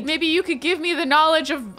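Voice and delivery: ordinary delivery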